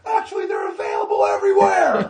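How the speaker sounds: very high, full shout from the crowd; heckler's yell. Retold or imitated. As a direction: bellow a correction at the stage. Heckler corrects the clown.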